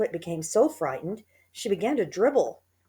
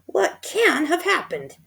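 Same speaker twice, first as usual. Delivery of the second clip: much higher, louder, slow puzzled woman; high slow fretful wife; Missus Twit voice; 11 semitones higher, 5 dB louder, slower than usual. A narrator's reading. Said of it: "Missus Twit said".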